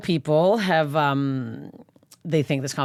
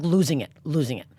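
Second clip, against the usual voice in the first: ordinary talk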